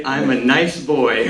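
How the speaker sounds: ordinary delivery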